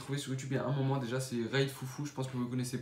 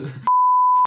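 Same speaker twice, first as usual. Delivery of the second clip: high burst of laughter; laughing out loud; 17 dB louder than usual. Real, not acted. Laughing at breaking the mood.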